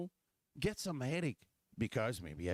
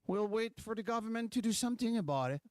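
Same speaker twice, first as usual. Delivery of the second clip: higher, fast sarcastic mimicry of a passive crowd; mocking quote; 9 semitones higher, faster than usual. Mocking Quebecers who wait for the government, higher and fast. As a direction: toss it off quickly in a mocking voice.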